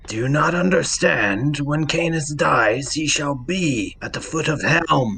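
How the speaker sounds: stately, insistent holy figure; impassioned cleric. Acted. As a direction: declare it with solemn conviction. Cleric figure answers Granite's outburst.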